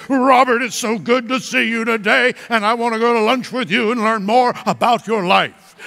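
loud, high, sermon-like greeting; show-off prayer voice. Acted. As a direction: greet a friend as if praying pompously in public. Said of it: "if you talk like that".

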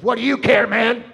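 put-on loud, defiant teen retort; rude snap. Preacher voicing a rude girl, loud and defiant. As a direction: bark it back like a sullen teen.

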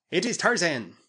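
high-pitched cry of recognition; alarmed cry. A narrator's reading. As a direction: call out a terrifying sighting. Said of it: "then their leader cried".

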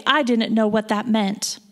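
ordinary delivery

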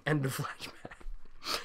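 hushed, breathy announcement; whispered aside. Acted. Reading fan fiction aloud, whispering the scene break. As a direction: mutter it like a stage aside.

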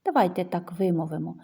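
usual speaking voice

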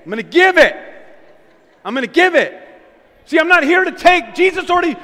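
loud, high fervent preaching; preaching at full force. Real, not acted. Preacher rallying the church with loud, high fervor.

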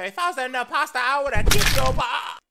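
loud threatening yell; angry shout. Acted. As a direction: bark the threat out. Threatening Red, getting heated.

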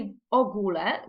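"в огуле" stressed on the O of огуле; exceptional Polish stress. Shown as a demonstration. Teacher repeating an exception to memorise, stress on O. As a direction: land on the first syllable of "огуле". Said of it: "не згідно правил розставляються".

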